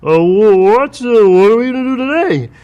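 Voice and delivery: high, slow, hesitant dim nephew; Wally impression. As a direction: stammer up high like a simple nephew. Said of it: "used to talk like this and go".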